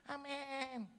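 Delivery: high, drawn-out chorus amen; congregation's amen. Retold or imitated. Ladies answering the preacher's birthday blessing, high drawn-out amen. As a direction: mimic a congregation answering in unison.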